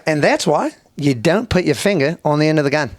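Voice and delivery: imitated instructive Aussie punchline; Australian accent. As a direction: deliver the lesson as an Australian bloke. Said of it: "Pretty, pretty good Australian accent".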